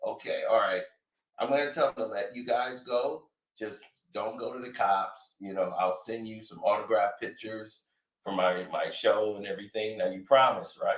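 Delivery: tired son pleading with his father. Retold or imitated.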